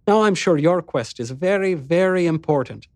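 high, mockingly earnest; gently mock his quest; teasing. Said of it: "in that teasing tone".

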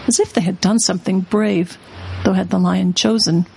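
ordinary delivery, nothing marked

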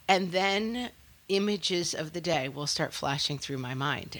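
ordinary delivery

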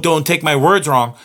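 ordinary talk

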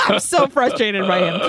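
ordinary talk

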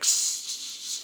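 long drawn-out "sss" hiss; sustained voiceless S sound; imitated hiss. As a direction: hiss out a string of S's. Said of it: "the band's just like".

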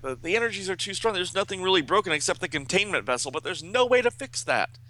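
high, quick, fretting genius; hurried, high-pitched despairing report; Brainy. A narrator's reading. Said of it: "and Brainy's like".

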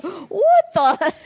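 startled loud outburst, laughing; shocked dad. Retold or imitated. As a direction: play the dad's stunned what the. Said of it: "And then I'm thinking, Dad is gonna be freaked".

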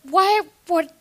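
high, fast, defensive protest; mock shocked denial. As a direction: act wildly offended and laugh it off.

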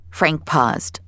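ordinary delivery, nothing marked

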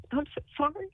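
high, teary voice breaking; tearful apology. Real, not acted. Wife nominating husband on radio, teary and breaking. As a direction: apologise through real tears. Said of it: "getting really emotional".

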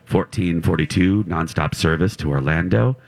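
lightly voiced airline announcement; flight attendant. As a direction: read it like a routine cabin announcement. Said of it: "pre-flight announcements are all pretty standard".

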